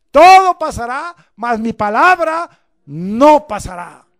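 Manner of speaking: fiery preaching. Acted. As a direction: thunder it out to the church. Preacher at full force.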